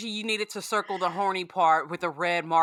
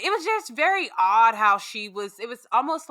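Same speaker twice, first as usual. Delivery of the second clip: normal talking voice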